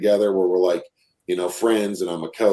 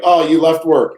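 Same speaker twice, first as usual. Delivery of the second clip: chirpy high, louder singsong alert; mock app notification; 9 semitones higher, 6 dB louder than usual. Dad mimicking the tracking app's alert, chirpy high voice. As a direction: chime it like a cheery phone alert. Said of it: "Yeah, it's like".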